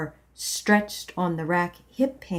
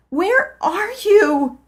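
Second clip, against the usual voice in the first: sobbed; 12 semitones higher, 7 dB louder, slower than usual. Acted. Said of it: "I sobbed".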